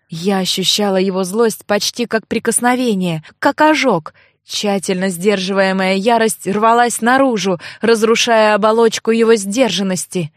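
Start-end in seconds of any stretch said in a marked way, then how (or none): none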